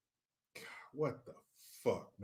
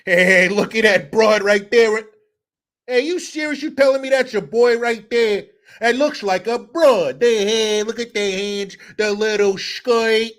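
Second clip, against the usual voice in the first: loud bro voice pointing at the hands; heckling buddies at a game; loud guy at the game; 4 semitones higher, 6 dB louder than usual. Pretend. Drunk fans at a game, loud heckling.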